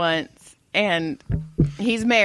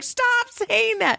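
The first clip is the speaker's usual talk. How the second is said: put-on whiny kid; much slower than usual. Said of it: "And he's like".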